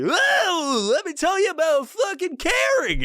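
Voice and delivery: very high, wailing dinosaur voice; squealing, sing-song mock suffering; skinned Barney. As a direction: impersonate a kids' TV dinosaur in agony. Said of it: "like, Barney the dinosaur that was skinned".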